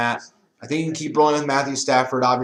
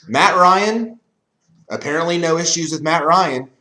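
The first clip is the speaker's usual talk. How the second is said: ordinary talk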